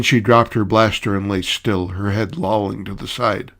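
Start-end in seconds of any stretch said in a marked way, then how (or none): none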